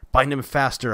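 loud, high order; impatient cry. A narrator's reading. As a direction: snap the command out. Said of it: "he cried".

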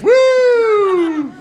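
long, high-pitched "woo"; drawn-out mock cheer; high whoop. Mock cheer after own sad story.

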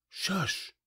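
soft, high hush; shushing. A narrator's reading. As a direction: hush them so the baby sleeps. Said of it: "I said".

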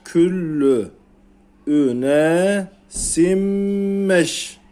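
üne simmeş stretched out very slowly with a held double m; teacher modelling tajwid slowly; very slow recitation. Shown as a demonstration. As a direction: drag out simmeş for the class. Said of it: "Okuyalım".

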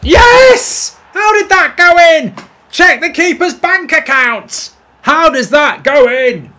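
high, full-on real shout; ecstatic goal celebration. Real, not acted. Gamer amazed his shot went in, ecstatic high shouting. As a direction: yell it out in amazed triumph.

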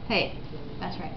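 ordinary talk

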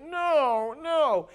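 high, loud drawn-out wail of refusal; mock pleading. Child hears a spanking is coming, high mock pleading. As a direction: plead like a kid dreading punishment.

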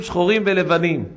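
ordinary talk

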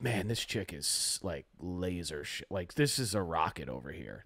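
low, soft inner voice, talking to himself; thinking it privately under his breath; inner thought voice. Retold or imitated. Retelling what he thought at the juice bar, low and soft. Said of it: "This is also going on in my mind".

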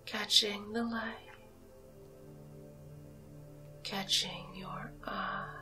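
usual speaking voice

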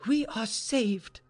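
high, drawn-out exclamation; sudden cry. A narrator's reading. Maid tells her mistress of escape, excited cry. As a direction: announce deliverance, slowly and high. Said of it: "she cried suddenly".

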